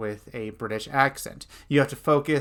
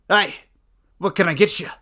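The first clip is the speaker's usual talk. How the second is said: stout dwarf innkeeper, higher and louder; engaging dwarf voice; 5 semitones higher, 6 dB louder, faster than usual. Shown as a demonstration. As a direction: greet a customer as a hearty dwarf. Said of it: "best aspects of using a voice".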